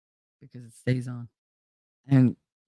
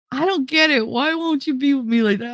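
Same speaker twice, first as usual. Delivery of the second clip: pleading friend; 11 semitones higher than usual. Retold or imitated.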